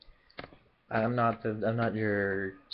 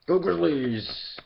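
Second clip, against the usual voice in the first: fan cheer; 7 semitones higher, 6 dB louder, much slower than usual. Real, not acted. Cheering for Memphis team.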